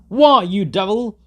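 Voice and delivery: high, loud outraged cry; old man in the crowd shouting at the driver; old man's shout. A narrator's reading. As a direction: yell at him in disgust. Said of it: "shouted an old man in the crowd".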